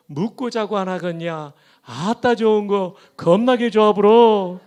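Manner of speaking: 전라도 사투리로 신나게; Jeolla dialect. Shown as a demonstration. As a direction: tell it like a Jeolla in-law, full of relish. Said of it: "전라도 버전입니다".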